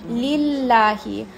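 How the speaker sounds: "लिल्लाही" slow with a light, thin lam; light lam demo. Shown as a demonstration. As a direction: model "लिल्लाही" slowly, lam kept light. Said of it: "अब यहाँ पर है लिल्लाही". ("लिल्लाही" धीमे, हल्का लाम)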